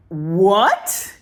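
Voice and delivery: loud, rising, incredulous what; hammed-up astonished outburst; mock shock. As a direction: blurt it out in exaggerated disbelief. Acting the directors' shocked reaction.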